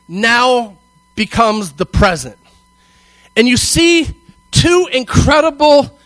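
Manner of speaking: slow, high and loud, building; preaching at full force. Acted. Preacher building the climax for the congregation. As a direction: preach it with rising passion.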